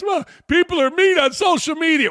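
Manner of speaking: sarcastic high whine of a coach defending his players; mocking coach excuse. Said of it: "we gotta listen to this?".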